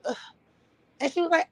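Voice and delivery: ordinary talk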